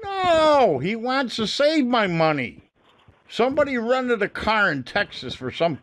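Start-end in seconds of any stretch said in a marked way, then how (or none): 0.0-2.6 high, drawn-out "No" in exasperation; exasperated retort. Real, not acted. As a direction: pitch it way up, stretch the "No". Angry caller retorts to agent.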